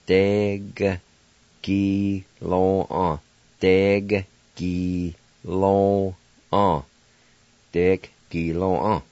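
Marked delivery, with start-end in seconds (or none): 0.1-6.8 Deg, gi, lo, an said one syllable at a time, each cut off; teacher sounding out each Cherokee syllable. Shown as a demonstration. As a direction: sound out Deg gi lo an slowly with pauses. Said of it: "pronounce the G sound like this".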